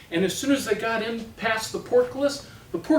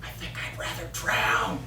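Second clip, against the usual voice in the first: whispered, softer knight aside; 4 semitones lower, 7 dB quieter than usual. Acted. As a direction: mutter it so she can't hear. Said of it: "turned to the other and says".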